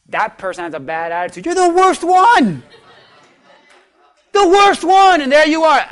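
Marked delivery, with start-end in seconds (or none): 1.4-5.2 loud, high, slow jab at the neighbor; mock accusing shout; mock accusation. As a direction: point the finger and call them the worst, hammed up. Mocking the attitude checker.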